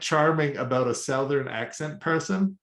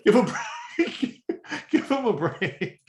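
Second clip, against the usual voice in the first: laughing; 7 semitones higher, slower than usual. Real, not acted. Joking defense, laughing through.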